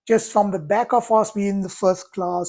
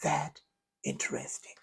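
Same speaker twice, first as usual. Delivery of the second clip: softer, ending almost breathed on "interesting"; calm curiosity; 5 semitones lower, 9 dB quieter than usual. Shown as a demonstration. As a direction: hush it down as you ask. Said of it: "I say".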